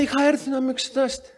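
a hint of an eager man introducing himself; cheerful patient. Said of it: "Λέει".

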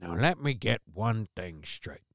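Columbo. Pretend. Host slipping into a mild Columbo voice about the dead woman. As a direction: puzzle it over like a rumpled detective. Mock detective voice after Columbo.

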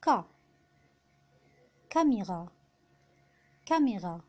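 "كاميرا" with a hard k, French r; French "كاميرا", C pronounced as k. Shown as a demonstration. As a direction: say "ك" alone, then "كاميرا" starting on k. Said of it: "أو ال U بيتنطق".

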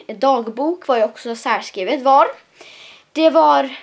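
ordinary talk

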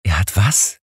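startled outburst, rising on was; a stunned young pastor blurting out; entfahren. A narrator's reading. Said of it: "entfuhr es unserem jungen Pastor". (fassungsloses Herausplatzen mit steigendem „was“)

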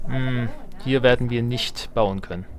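normal talking voice

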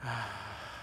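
soft, short breath out; meditative sigh. Real, not acted. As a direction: let out a gentle, quiet sigh.

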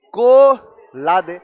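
"एसी... को... ला दे" drawn out, gaps between pieces; broken-up mnemonic. Shown as a demonstration. Teacher recalling how the word was built, slow and split. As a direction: stretch each chunk very slowly for the class. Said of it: "क्या शब्द बना था".

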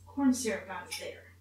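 ordinary talk, nothing marked

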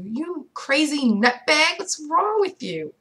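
high, slow, disbelieving; high, slow cry of disbelief; incredulous outburst. Real, not acted. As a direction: scold him in high-pitched disbelief. Outburst at the stalker.

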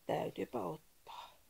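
slow, strained voice put on for a sore throat; faking a sore throat. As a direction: croak it out as if your throat hurts. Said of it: "on ollut vähän kurkku kipeänä".

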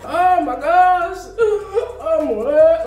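high, loud, drawn-out wail; hammed-up sobbing like a movie mourner; mock loud crying. Imitating the crying her teacher supposedly saw, mock wailing. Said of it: "very loud when they cry".

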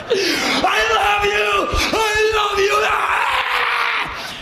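very high, drawn-out shrieks of adoration; psycho happy screaming. Retold or imitated. Actor shakes assistant like a rag doll, ecstatic shrieking. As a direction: scream it like a crazed child meeting a hero. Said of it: "be a psycho who's really happy to see this person".

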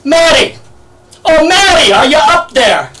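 high, loud call up to a balcony; yelling up. Retold or imitated. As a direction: holler up to a neighbor. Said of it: "he goes there and he yells".

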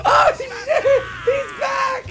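high, loud, joyful yelling; real overjoyed scream; ecstatic shout. Real, not acted. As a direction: cheer as your hero walks back in. Favourite character returns.

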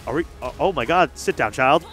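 usual speaking voice

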